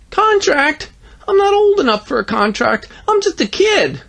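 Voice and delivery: high, loud and alarmed; young boy voice. Acted. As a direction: squeak it like a panicky kid. Kid Phil voice.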